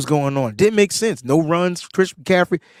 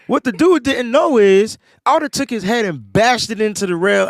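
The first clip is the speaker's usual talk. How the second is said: ordinary talk, nothing marked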